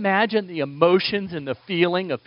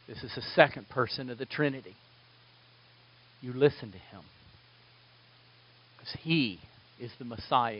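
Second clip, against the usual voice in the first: softer, hushed and grave divine voice; solemn God the Father voice; 6 semitones lower, 9 dB quieter than usual. Retold or imitated. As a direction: speak low like a weighty revelation. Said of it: "what has God the Father spoken".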